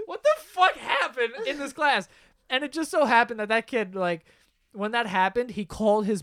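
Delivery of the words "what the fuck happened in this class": high, laughing mock astonishment; incredulous high-pitched retold question; baffled disbelief. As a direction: imitate stunned teachers reacting. Shocked teachers read the sheet.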